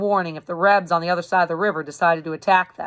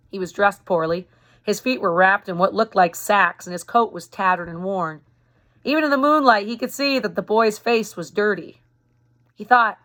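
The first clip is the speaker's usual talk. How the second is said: usual speaking voice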